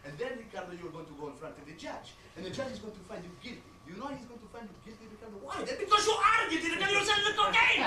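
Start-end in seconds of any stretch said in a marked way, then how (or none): 4.0-7.8 high, very loud fatherly outburst; accented dad yelling at high pitch; exasperated shouting. Acted. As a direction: explode at your son. Father's outburst at his son.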